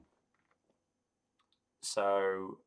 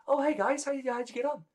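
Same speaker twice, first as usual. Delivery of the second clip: bright, much higher guild leader voice; cheerful high voice; 5 dB louder than usual. Acted. Guild leader greeting the returning party, high and chipper.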